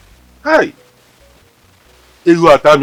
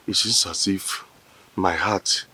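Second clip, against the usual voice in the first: much softer, lower, fading voice; faint and dizzy; 7 semitones lower, 14 dB quieter than usual. Acted. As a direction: sound as if about to faint. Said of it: "I'm feeling dizzy now".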